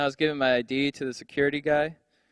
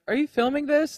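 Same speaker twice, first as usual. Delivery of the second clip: mock girlfriend; 11 semitones higher than usual. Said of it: "She's like".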